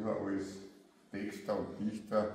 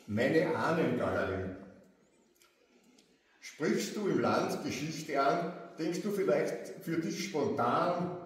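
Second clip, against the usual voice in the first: poem recital; 5 semitones higher than usual. Acted. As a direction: announce the next poem and begin. Starts the next poem. (langsamer Gedichtvortrag)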